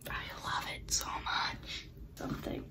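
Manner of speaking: unvoiced secret whisper. Real, not acted. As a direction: breathe it out as a whisper. Whispering to herself over laptop.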